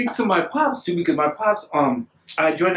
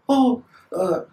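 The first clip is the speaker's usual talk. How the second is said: put-on high-pitched native-accented Spanish speaker; native Mexican accent; 7 semitones higher than usual. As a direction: imitate his native accent, voice lifted high. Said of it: "you know, in his accent".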